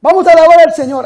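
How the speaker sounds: loud, high, fast call to praise; rally the crowd joyfully; eager praise call. Retold or imitated. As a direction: charismatic calling out to praise the Lord. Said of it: "A veces".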